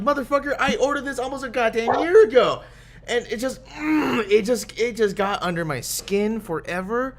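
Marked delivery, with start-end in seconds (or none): 0.0-2.6 higher, exasperated mock rant; retold angry outburst. Fed-up customer ranting at the parts company, pitched higher. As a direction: vent the old fury again, half acting it. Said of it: "It, it just fucking pissed me off".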